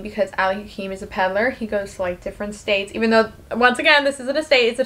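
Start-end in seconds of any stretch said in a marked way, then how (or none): none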